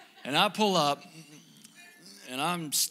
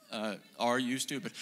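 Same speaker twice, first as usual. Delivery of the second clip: calm retort; 3 semitones lower than usual. Retold or imitated. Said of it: "And I would say".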